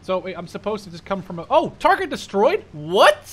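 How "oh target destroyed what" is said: much higher, louder, slow disbelief; puzzled outburst at the screen; startled confusion; surprised. Real, not acted. Unexpected kill message appears.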